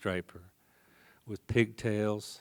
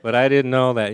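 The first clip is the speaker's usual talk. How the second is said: ordinary talk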